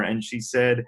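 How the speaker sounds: usual speaking voice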